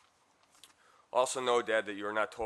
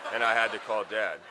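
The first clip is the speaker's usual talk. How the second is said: laughing; 8 dB louder than usual. Real, not acted. Recalling being arrested at camp.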